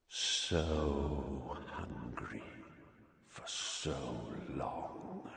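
low, soft, menacing hiss; sinister monster voice. A narrator's reading. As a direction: creep each word out cold.

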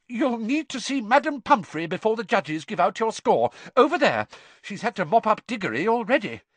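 high, brisk instructions; brisk teacher. A narrator's reading. McGonagall directing Harry to the nurse, brisk. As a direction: send him off briskly. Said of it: "Professor McGonagall".

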